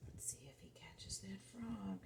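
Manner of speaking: hushed whisper, building suspense. Acted. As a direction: whisper it to draw the child in. Suspense while reading to a child.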